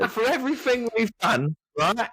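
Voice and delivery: high, put-on Cockney challenge; mock Cockney devil's advocate, pitched high; Cockney accent. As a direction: argue it like a cheeky Cockney. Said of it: "this person's got an accent".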